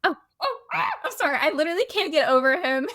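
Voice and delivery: high, giggly burst of laughter; giggling apology. Real, not acted. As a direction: squeal it out while laughing at yourself. Breaks into giggles mid-rant.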